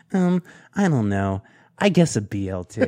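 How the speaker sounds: a shrugging, indifferent answer; fiancee's reply. Retold or imitated. Imitating his fiancee ordering a BLT. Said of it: "she looks at me and goes".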